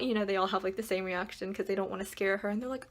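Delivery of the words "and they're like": ordinary talk, nothing marked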